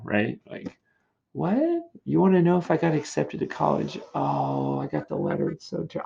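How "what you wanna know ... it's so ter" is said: high surprised question sinking into mock gloom; teasing pretence. Student teasing about a college letter, feigned disappointment. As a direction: fake bad news to tease. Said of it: "That's how we mess with people".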